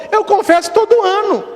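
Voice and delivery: put-on high, frail-sounding old woman; old lady voice. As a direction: play the little old lady answering the priest.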